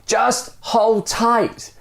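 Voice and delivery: high, slow yell. Acted. Dangling stranger yelling up to the man, slow high yell. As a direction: call up the order, drawn out. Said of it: "the stranger yells".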